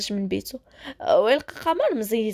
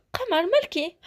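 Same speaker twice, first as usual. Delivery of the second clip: higher-pitched worried question; mildly fearful concern, pitched up; 7 semitones higher than usual. A narrator's reading. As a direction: ask with a touch of alarm. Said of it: "مشى عندها بخوف وقال لها".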